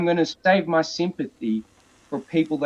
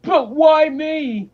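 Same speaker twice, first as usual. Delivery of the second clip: high, slow mock whine, much louder; whiny victim; 7 dB louder, much slower than usual. Mocking a fallen bully whining, high and slow. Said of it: "And then they go".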